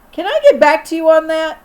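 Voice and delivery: cheeky yelled reply. A narrator's reading. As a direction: call it back over your shoulder. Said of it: "I yelled as I tried to run off".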